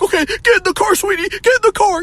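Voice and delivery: fake-sweet urgent parent voice. Parent hurrying son into the car, high mock sweetness.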